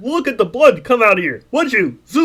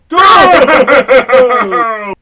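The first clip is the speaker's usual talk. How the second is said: a big, loud tearful laugh of relief; loud joyous laugh-cry; 6 dB louder than usual. Acted. George overjoyed running home, tearful loud laugh. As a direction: laugh out loud through happy tears.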